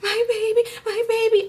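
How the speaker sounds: very high mock whimper; anguished high-pitched cry; imitated distressed wail. Pregnant woman squatting by her car, imitated distress.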